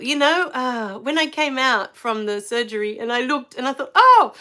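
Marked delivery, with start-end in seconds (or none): 3.9-4.3 loud, high-pitched surprised "oh"; startled oh, much higher and louder. Retold or imitated. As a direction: react with sudden surprise, voice jumping up. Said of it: "So I was really surprised".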